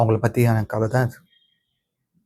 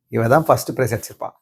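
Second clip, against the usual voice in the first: normal talking voice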